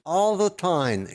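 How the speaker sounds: a light take on her smiling ventriloquist style; imitating Darcy Lynn. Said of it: "does it like this".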